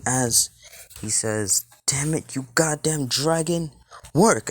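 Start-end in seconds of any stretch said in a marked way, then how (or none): 1.9-4.5 high, frustrated outburst building louder; angry cursing. Acted. Rei demanding his dragon work, angry and frustrated. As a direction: curse at it, punching the last word loudly.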